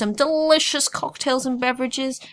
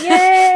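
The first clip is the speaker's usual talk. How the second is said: loud burst of real laughter; 9 semitones higher, 11 dB louder than usual. Real, not acted. Co-host laughs loudly at having only two reviews, real laughter.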